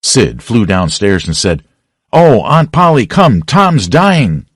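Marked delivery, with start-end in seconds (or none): 2.1-4.5 higher, louder, slow, pleading alarm; urgent cry for help, dragging out the words. Acted. Sid running downstairs crying to Aunt Polly that Tom is dying, slow and loud.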